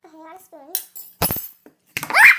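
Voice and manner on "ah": high loud startled shriek; surprised shriek. Real, not acted. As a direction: let out a sudden shriek. Reaction to the spoonful.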